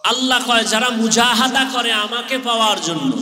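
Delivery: ordinary delivery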